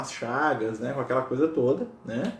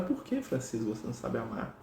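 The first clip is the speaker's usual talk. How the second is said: very soft, understanding question; compassionate; 3 semitones lower, 11 dB quieter than usual. Retold or imitated. Jesus looking kindly at Francis, compassionate. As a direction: ask gently, almost under your breath. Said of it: "compassivo, compreendido, compreensivo".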